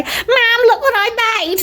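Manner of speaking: put-on high, excited child-like call to Mum; high-pitched, drawn-in-earnest "look at me"; proud showing-off call. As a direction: call out to Mum to show off your work. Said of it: "Mum's not here for me to say".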